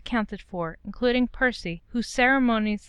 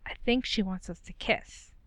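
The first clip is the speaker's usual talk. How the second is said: softer, hushed aside; a hint of a conspiratorial hush; whispered; 7 dB quieter than usual. A narrator's reading. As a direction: lean in and murmur it to him. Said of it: "Ron whispered".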